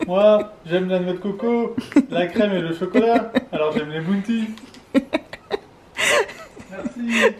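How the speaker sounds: put-on high, loud, slow childlike voice; silly childish voice. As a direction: play a delighted little kid. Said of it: "on parle comme des abrutis".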